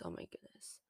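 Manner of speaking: "Oh my goodness" breathed out in a whisper, no voice. Real, not acted. Podcaster overwhelmed by the finale, whispering. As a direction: drop to a hushed whisper on "Oh my goodness". Overwhelmed aside about the ending.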